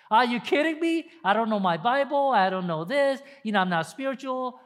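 high, loud disbelieving protest; mock incredulous believer; incredulous excuse. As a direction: scoff at being asked. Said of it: "what a lot of people ... are saying".

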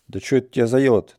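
quick, startled and fast; Ivan, alarmed, hurries to calm the old man; taken aback. A narrator's reading. Иван испуганно и быстро спрашивает деда. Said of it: "напугался Иван".

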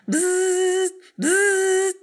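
high, loud buzzing bee sound; doorbell buzz. Acted. Matilda ringing Miss Totsie's doorbell, a loud high buzz. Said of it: "buzzing like a big fat bee".

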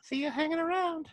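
put-on fast, creepy old man voice. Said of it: "sound like that old pedophile on Family Guy".

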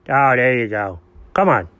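ordinary talk, nothing marked

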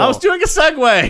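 high, loud mock-indignant defence; loud exasperated protest. Real, not acted. Co-host defending a segue, loud high protest.

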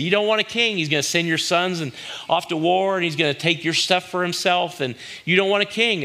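high, pleading warning; exasperated Samuel. Retold or imitated. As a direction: plead with them to see sense.

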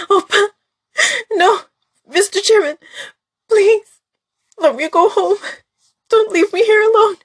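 high, crying, desperate begging; sobbing plea. Acted. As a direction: wail through tears, begging not to be left.

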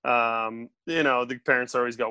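ordinary delivery

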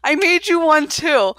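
giggly mock cheerful offer; chuckling as the eager girlfriend; laughing while quoting. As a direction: say it through a laugh. Casey offering the suit.

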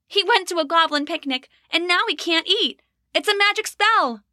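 put-on high insistent girl voice; a young girl pressing her case; child's voice. Rose insists to parents.

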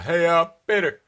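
loud, high character impression; Joe. Comedian showing listeners he can do voices, loud impression.

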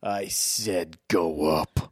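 slow low menacing order; menacing villain. Acted. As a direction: lean into the threat.

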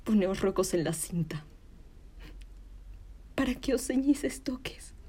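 ordinary talk

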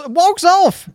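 normal talking voice

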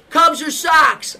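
slow, loud, high holler; drawn-out retold shout; shouted question. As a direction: bellow it down the phone. Retold big phone question, shouted.

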